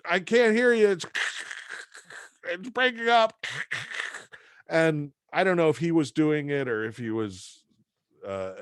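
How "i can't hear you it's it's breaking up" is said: much higher, chipper salesman voice; cheery Joe. Retold or imitated. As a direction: sell Milwaukee like a booster. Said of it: "he's like".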